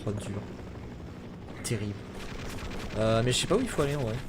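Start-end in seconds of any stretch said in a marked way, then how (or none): none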